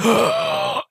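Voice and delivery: big high-pitched gasp; shocked mock gasp. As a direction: suck in air in play-acted horror. Imitates the other man's shocked gasp.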